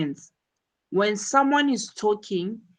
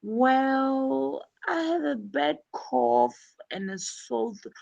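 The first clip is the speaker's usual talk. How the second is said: hesitant patient; 6 semitones higher than usual. Shown as a demonstration. Teacher voicing Kathy stalling for time before the doctor. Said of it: "buying time by saying".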